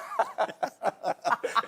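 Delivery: laughing. Real, not acted. Laughing at lisp joke.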